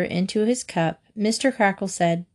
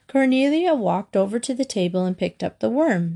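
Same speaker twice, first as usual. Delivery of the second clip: ordinary delivery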